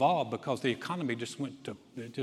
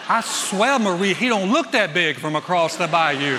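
put-on Cajun accent; 3 semitones higher, 11 dB louder than usual. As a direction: shout it out as an alarmed Cajun husband. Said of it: "excuse my Cajun accent".

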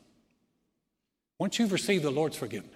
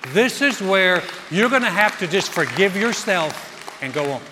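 loud, raised, driving sermon delivery; preaching; 5 semitones higher, 13 dB louder than usual. Real, not acted. Pastor urging congregation to forgive themselves, forceful preaching. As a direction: preach the challenge at full force.

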